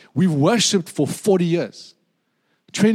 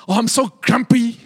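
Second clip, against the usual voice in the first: grumpy deacon; 5 semitones higher, 3 dB louder than usual. Retold or imitated. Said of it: "came to me and said".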